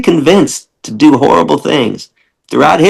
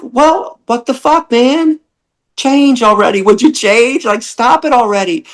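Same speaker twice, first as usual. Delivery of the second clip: much higher, exasperated rant; exasperated high scolding; 10 semitones higher than usual. Retold or imitated. [name] berating tearful visitors, high and exasperated. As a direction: throw up your hands at them. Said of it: "I'm like".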